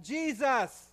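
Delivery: loud, high, desperate pleading prayer; crying out. Pretend. Worshipper crying out to God for mercy, loud desperate plea. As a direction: beg God from the gut. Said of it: "wants to cry out".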